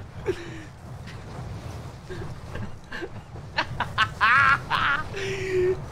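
long helpless fit of laughter. Real, not acted. Gamer who can't cope with the spawn, long laughing fit. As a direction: lose it completely and keep laughing. Player laughs at absurd respawn.

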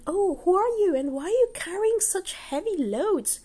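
a surprised housewife calling out to strangers; wife's voice. Acted. Wife questioning the two boys at her door, high and wondering. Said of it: "Arjuna Acharya's wife said".

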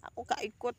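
ordinary delivery

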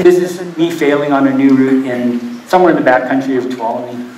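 ordinary talk, nothing marked

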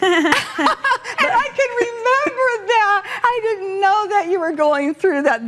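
laughing. Real, not acted. Laughing at host's story.

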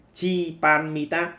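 chi palmitat slowly stretched with a rise; slow dictation. Shown as a demonstration. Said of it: "cách thứ hai là glycerin".

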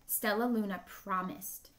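ordinary delivery

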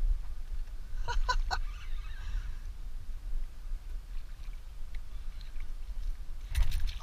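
airy, breathless giggle, high up; high giggling laugh. Real, not acted. Angler watching fish go nuts, high breathless laughter. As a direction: laugh helplessly at the chaos.

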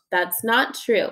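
ordinary delivery, nothing marked

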